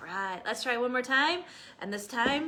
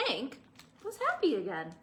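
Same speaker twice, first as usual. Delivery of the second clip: plain reading voice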